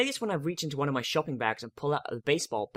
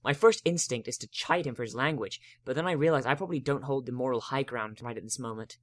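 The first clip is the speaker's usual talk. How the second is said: usual speaking voice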